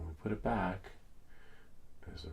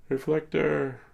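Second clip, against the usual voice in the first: sing-song call; 10 semitones higher, 8 dB louder, slower than usual. Real, not acted. Relaunching the app.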